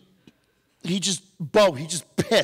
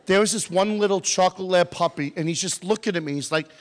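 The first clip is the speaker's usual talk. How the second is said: ordinary talk, nothing marked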